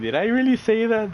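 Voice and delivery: high, disbelieving burst; shocked self-question. Retold or imitated. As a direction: ask yourself in sudden shock. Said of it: "He was thinking".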